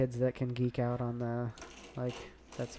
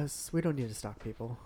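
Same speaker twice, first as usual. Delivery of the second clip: normal talking voice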